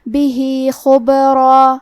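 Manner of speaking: بھی stretched two counts, خبرا slow; two-count madd. Shown as a demonstration. Teacher models madd sila in بھی خبرا, slow. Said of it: "دو مد کے برابر لمبی کریں گے".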